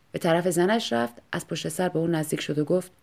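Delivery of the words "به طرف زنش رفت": ordinary delivery, nothing marked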